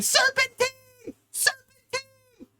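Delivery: very high, slow shrieking; high, drawn-out mock scream; panicked screaming women. Women running around screaming.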